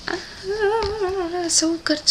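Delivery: melting over a favorite romantic quote; gushing fangirl. Real, not acted.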